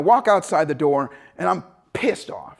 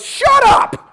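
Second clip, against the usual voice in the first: high, loud shouted Shut up; mock yell; 9 dB louder than usual. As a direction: bark it to cut him off. Instructor yells at him.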